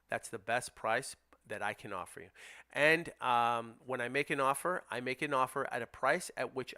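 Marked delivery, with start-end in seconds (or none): 0.1-2.3 softer, calm buyer's pitch to the seller; calm, reassuring script. Shown as a demonstration. Investor calmly telling the sellers he can't pay more. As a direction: reassure the seller gently, firm underneath. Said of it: "that's how you handle that objection".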